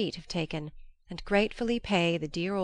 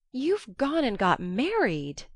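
astonished; 5 semitones higher, 3 dB louder, slower than usual. A narrator's reading. Joe discovers Laurie married.

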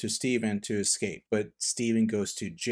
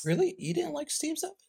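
much higher, disbelieving question; surprised question; 3 dB quieter than usual. Real, not acted. Asking Mom why she skipped Steve's outfit, surprised disbelief. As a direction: sound genuinely taken aback. Surprised reply to Mom's choice.

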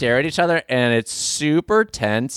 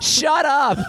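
high mock yell; playful shout. Real, not acted. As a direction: yell it teasingly. Mock outburst at friend.

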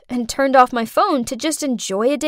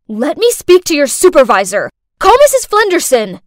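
louder, pitch climbing into an outburst; entitled tantrum, rising in volume; indignant demand; 3 semitones higher, 7 dB louder than usual. Acted. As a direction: demand the supervisor, building to a loud order. Acted demand during a tantrum.

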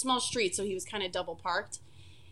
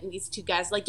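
ordinary delivery

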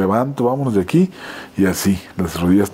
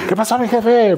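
high, chummy street-dealer greeting, voiced much higher; shady dealer; 12 semitones higher than usual. Bathroom stranger mistakes the actor for a buyer, high chummy hustler voice. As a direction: greet the boss like a sly hustler.

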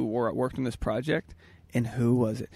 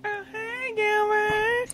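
squeaky high voice; much slower than usual. Pretend. Answering in a character voice.

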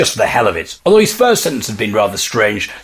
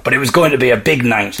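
ordinary delivery, nothing marked

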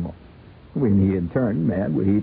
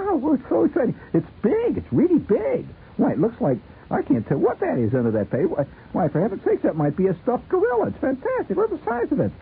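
much higher, gushing excitement; thrilled Sheila. Pretend. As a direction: squeal over the huge present.

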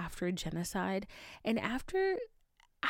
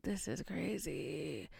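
low, slow murmur of disbelief; under the breath, slowly; softly stunned, slowed down; quiet slow aside; 3 semitones lower, 7 dB quieter, slower than usual. Retold or imitated. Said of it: "And I'm like".

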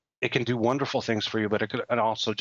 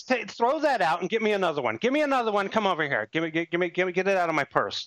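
much higher mock-befuddled voice, slowing at the end; mocking a clueless politician; 7 semitones higher than usual. Mocking the candidate who didn't understand her cell phone, high and befuddled. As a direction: play a flustered candidate baffled by servers.

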